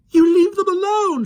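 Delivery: Michael screaming as he grabs the leg; screamed. A narrator's reading. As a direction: scream at the policeman. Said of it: "screamed Michael".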